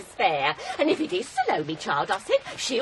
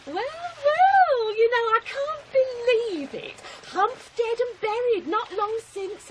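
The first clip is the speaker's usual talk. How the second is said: mock surprise; 8 semitones higher, slower than usual.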